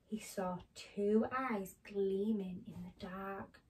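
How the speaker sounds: animated picture-book reading; story narration to children. A narrator's reading. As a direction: read it to small children with careful, dramatic phrasing.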